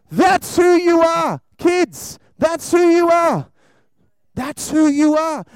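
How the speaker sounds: loud, slow, declaring; emphatic preaching. Real, not acted. Preacher hammering the point home.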